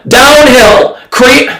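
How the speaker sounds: normal talking voice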